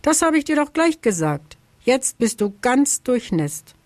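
high-pitched storybook telling-off; scolding. A narrator's reading. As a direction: chide them with an I-told-you-so lilt. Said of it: "schimpft er".

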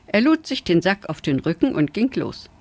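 plain reading voice

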